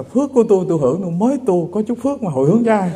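put-on argumentative old woman. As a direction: rattle it off like a cranky old lady. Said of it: "Bà lý sự lắm".

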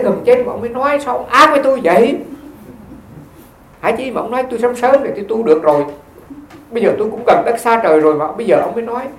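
acted reproachful mother, loud and high; high, emotional complaint of an elderly mother; moved old mother. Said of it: "Bà mới nói một câu mà rất là cảm động".

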